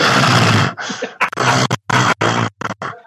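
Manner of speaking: low, noisy mock panting; exaggerated heavy breaths, drawn out; imitated heavy breathing. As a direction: imitate someone breathing heavily at a person. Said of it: "heavy breathes at you".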